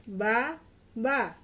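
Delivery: Ba and a each said loud and slow, apart; over enunciating. Shown as a demonstration. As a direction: sound out each syllable, Ba then a. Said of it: "ikutin Mama ya".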